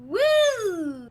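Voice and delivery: high loud whooping; silly clown whoop. Acted.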